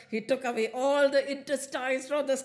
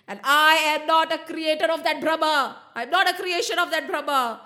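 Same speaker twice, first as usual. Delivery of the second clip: higher, louder, ringing proclamation; defiant declaration; 5 semitones higher, 4 dB louder than usual. A narrator's reading. Narasimha tells the demon king what he is, defiant. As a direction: proclaim it with force. God defies his enemy.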